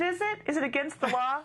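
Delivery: high, mock-innocent "is it?"; sarcastic challenge. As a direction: throw his words back, feigning surprise. Mother mocking the clerk's claim.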